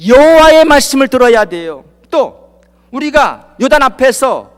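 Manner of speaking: loud, rising sermon with stretched words; full-force preaching. Acted. Preacher pressing the congregation with rising force.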